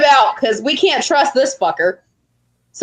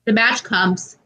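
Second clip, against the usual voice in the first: ordinary talk, nothing marked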